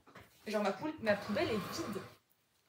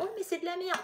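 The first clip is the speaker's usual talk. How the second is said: high-pitched dismissive whine of a quote; exaggerated imitation of a complainer; mock complaint; 7 semitones higher than usual. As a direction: imitate a disappointed shopper, pitched up. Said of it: "tu vas dire".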